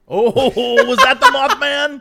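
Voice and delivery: ordinary talk, nothing marked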